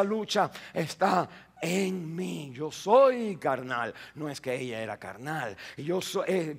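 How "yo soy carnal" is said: "soy" despacio y firme; declaración lenta. Real, not acted. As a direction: pesar cada palabra. ("Yo soy carnal" recalcado)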